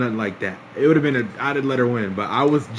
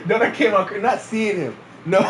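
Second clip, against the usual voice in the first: higher-pitched, words through laughter; laughing protest; 7 semitones higher than usual. Real, not acted. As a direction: correct yourself while cracking up. Laughing at own slip of wording.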